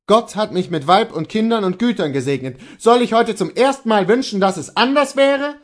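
high, loud appeal from the husband; dismayed Kohlhaas. Acted.

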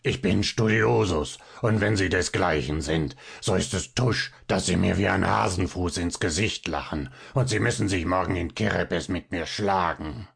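lightly voiced proud little man; mild indignant challenge. A narrator's reading. Little man demands a duel from Fabian, mildly indignant.

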